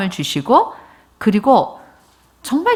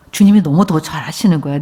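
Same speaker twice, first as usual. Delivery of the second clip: normal talking voice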